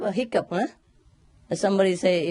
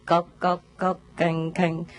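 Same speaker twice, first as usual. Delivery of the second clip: imitated temple chanting beats; 2 semitones lower, slower than usual. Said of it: "listen to the monk".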